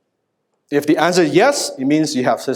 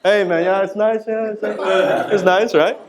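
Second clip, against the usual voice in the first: higher-pitched, mock-casual chat; awkward small talk; 6 semitones higher than usual. Two naked friends talking in the hot spring, awkwardly casual. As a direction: act out stilted buddy talk, avoiding a look. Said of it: "we were talking like this".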